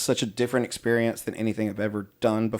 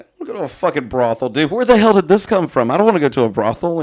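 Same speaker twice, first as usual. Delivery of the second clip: indignant retort; 5 semitones higher, 7 dB louder than usual. Retold or imitated.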